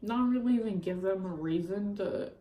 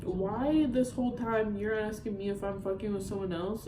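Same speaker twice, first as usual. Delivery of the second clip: normal talking voice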